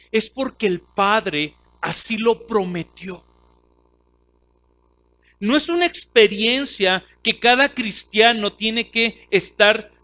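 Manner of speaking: full-force preaching. Acted. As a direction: drive the point home to the congregation. Preaching point about the Spirit.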